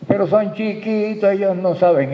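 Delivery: mock parent objection.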